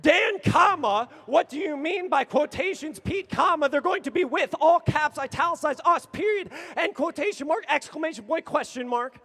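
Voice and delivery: loud and high, all punctuation yelled; near shout. Retold or imitated. Repeating the question a last time, shouting in disbelief. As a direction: yell the message at full frustration. Escalating exchange peaks.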